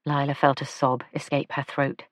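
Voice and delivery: ordinary delivery, nothing marked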